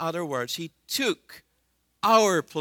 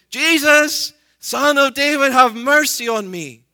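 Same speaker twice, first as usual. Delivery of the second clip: full-voiced desperate shout, much higher and louder; 9 semitones higher, 12 dB louder than usual. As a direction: cry out with everything you have. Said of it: "Even louder".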